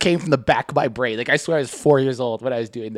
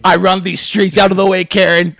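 mock swagger; 4 semitones higher, 6 dB louder than usual. Mock tough kid boasting.

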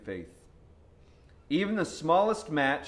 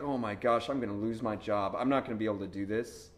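ordinary talk

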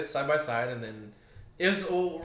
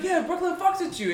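put-on Brooklyn accent; 14 semitones higher, 3 dB louder than usual. Retelling his drunk friend's Brooklyn character, a high put-on Brooklyn accent. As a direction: mimic a buddy's hammed-up Brooklyn accent, pitched way up. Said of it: "my Brooklyn accent".

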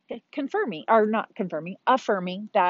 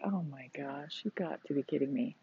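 stunned aside; 5 semitones lower, 12 dB quieter than usual. Retold or imitated. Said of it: "And I'm like".

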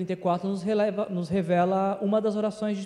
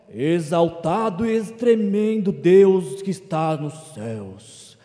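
slow, sung, louder churchy intonation; mock pious prayer voice; 6 dB louder, much slower than usual. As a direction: ham up a solemn pompous prayer tone. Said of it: "A pessoa muda o tom da voz".

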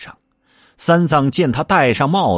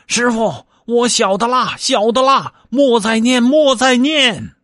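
high, louder pained wail, slowing at the end; pleading in pain; 13 semitones higher, 4 dB louder than usual. Acted. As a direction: beg for mercy, dragging out the last words. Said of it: "只叫".

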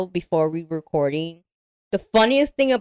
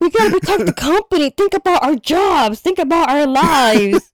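mock company man; 8 semitones higher, 4 dB louder than usual. Mocking the company man's plea.

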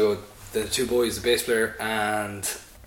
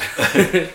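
loud laugh; 8 semitones higher, 8 dB louder than usual. Real, not acted. Laughing at dad's playing.

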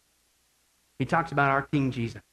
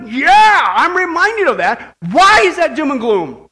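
rousing preacher building to a shout; fervent preaching; 7 semitones higher, 9 dB louder than usual. Real, not acted. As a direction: preach it with mounting fervour. Preaches at full force.

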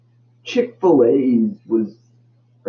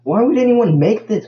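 high, loud incredulous why; can't believe anyone made this; exasperated disbelief; 11 semitones higher, 4 dB louder than usual. Real, not acted. Vlogger baffled by giant crowns to viewers, exasperated high outburst.

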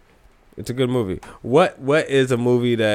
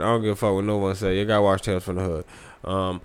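ordinary talk

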